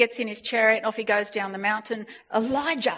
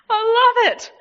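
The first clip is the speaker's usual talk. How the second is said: high and loud burst of delight; excited exclaim; 12 semitones higher, 6 dB louder than usual. Real, not acted. Preacher bursts out loving the bold prophet, high and loud. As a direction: cry it out with joy.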